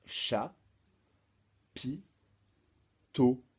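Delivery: chat, pi, tôt as isolated beats with long pauses; syllable by syllable. Shown as a demonstration. Teacher assembles the charade's answer, chat, pi, tôt separated. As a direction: separate chat, pi, then land loudly on tôt. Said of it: "première syllabe du mot".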